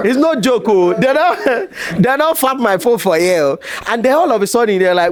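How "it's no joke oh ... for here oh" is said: high, excited, laughing through the words; laughing protest. Retold or imitated. Retelling to the crowd, laughing excited protest. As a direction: insist it's no joke while laughing. Telling crowd phone was stolen.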